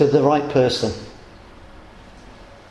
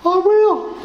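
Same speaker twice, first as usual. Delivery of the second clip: high, squeaky nervous "I will"; 14 semitones higher than usual. Retold or imitated. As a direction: squeak it out like a groom caught off guard. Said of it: "in a rather squeaky voice".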